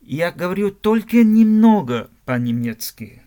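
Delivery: ordinary talk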